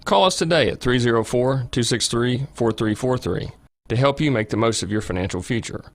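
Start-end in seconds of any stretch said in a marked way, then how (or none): none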